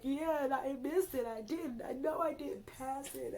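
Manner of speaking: high voice sinking to a whisper; rising despair then a hushed admission; despairing cry. Retold or imitated. Telling her husband she failed, high despair fading to whisper.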